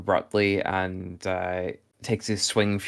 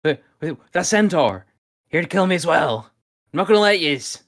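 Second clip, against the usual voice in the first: high, loud, frantic voice; panicked shock; 9 semitones higher, 6 dB louder than usual. Acted. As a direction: stammer and accuse them in wild-eyed panic. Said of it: "very clearly in a state of shock".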